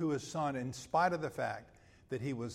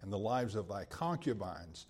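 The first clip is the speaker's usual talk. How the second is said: rising to a forceful rebuke, louder and higher; scripture reading, preaching force; 3 semitones higher, 5 dB louder than usual. Acted. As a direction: read Joab's rebuke with mounting force. Reads Joab's rebuke to David.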